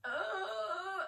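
high strained cry of frustration; a high, strained ugh like she's about to combust; frustrated groan. Retold or imitated. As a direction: let out a sharp overwhelmed ugh. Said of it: "and then I'm like".

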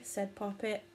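usual speaking voice

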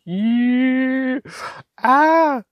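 high, loud delighted squeal; a burst of real joy; excited squeal. Real, not acted. Thrilled reaction to the label shimmer.

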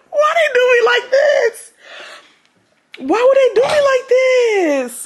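high, whiny mock-crying plea; high-pitched dramatic lament; wailing in embarrassment. Real, not acted. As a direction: wail it to the heavens, comically wronged. Wailing over screenshots of her.

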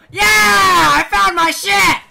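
high, full-volume yell of victory; triumphant shout. Real, not acted. Gamer finds his lost stuff, triumphant shout. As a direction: scream it out in triumph.

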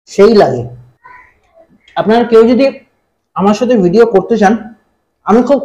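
eating with fingers; talking while eating. Real, not acted. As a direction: mouth partly full. Seen 0: Holds plate, squeezes lemon, brings food to mouth by hand.

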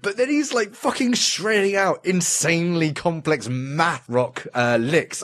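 high, gushing and delighted; excited. Real, not acted. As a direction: rave about the cute guitarist. Gushing over cute guitarist.

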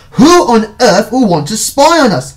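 high, loud disbelief; exclaiming cry. A narrator's reading. Brother reacts to sister's spy suspicion, crying out. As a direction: cry out incredulously. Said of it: "I cried".

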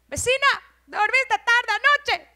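high, loud sing-out; calling to a neighbor. Acted. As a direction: holler nosily over to the neighbor. Calling out to the neighbor next morning.

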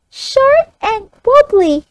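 put-on high child voice echoing back. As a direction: echo it like a young pupil. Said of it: "the child reads it back".

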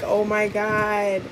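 drawn-out amazed oh my God; imitating excitement. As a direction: play the excited uncle. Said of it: "He's like".

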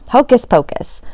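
mild magician's yell with flourish. A narrator's reading. Boy casts his spell on the bill with a mild magician's yell. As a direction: call it out like a stage magician, half-hearted. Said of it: "I yelled".

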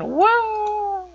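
high, loud squealed whoa; excited whoa. Retold or imitated. To viewers, squealing whoa mid-dance. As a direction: squeal it like a dance move thrill. Kid acting out dance move.

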